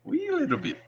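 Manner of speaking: soft, very high cartoon Irish; tiny falsetto Irish echo; hammed-up Irish squeak, kept quiet; mock Irish accent. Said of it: "I like Irish accents".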